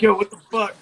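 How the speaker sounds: a loud, startled yo what the fuck; mock loud surprise. Telling how friends react on seeing him, mock loud surprise. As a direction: play the friend caught off guard, loud. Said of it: "And they like".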